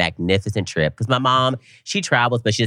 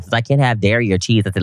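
ordinary delivery

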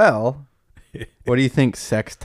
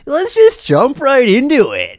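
YouTuber intro voice; 7 dB louder, slower than usual. Pretend.

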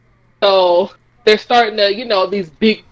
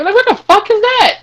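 high-pitched, louder incredulous question; exaggerated confusion, pitched up; mock bafflement; 14 semitones higher, 5 dB louder than usual. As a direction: play a clueless fan, baffled. Acted baffled audience reaction.

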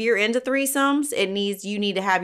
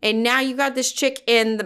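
usual speaking voice

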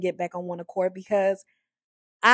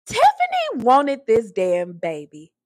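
usual speaking voice

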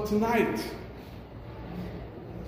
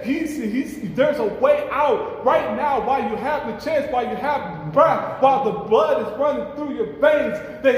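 raised, urgent preacher's voice; fervent preaching; 6 semitones higher than usual. Acted. Preacher warning the congregation about people in hell, fervent. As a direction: press the warning home.

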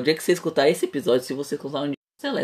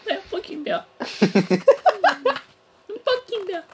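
laughing. Real, not acted. Laughs through repeated phrase.

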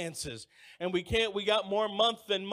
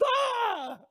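high mock scream of fright; startled scream; 14 semitones higher, 4 dB louder than usual. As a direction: squeal like she just got scared. Her scream retold.

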